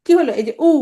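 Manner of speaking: ordinary talk, nothing marked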